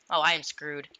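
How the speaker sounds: normal talking voice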